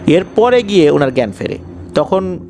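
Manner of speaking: ordinary delivery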